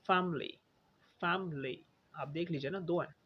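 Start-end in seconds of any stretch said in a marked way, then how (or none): 0.1-1.8 फैमिली repeated as fam-lee; no vowel between m and l in फैमिली; two syllables. Shown as a demonstration. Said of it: "दो syllable बन गए". (फैमिली दो syllable में: फैम-ली)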